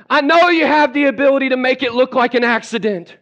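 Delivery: high-pitched, dramatic venting to God; exasperated plea. Acted. Churchgoer begging God to remove someone, exasperated plea. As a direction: plead in hammed-up exasperation. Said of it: "you may be saying".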